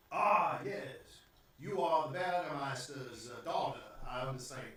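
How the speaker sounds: put-on southern-accented gentleman host; courtly put-on southern accent. Gentleman recognizes the Burgermeister's daughter, southern accent. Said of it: "Why does he got a southern accent?".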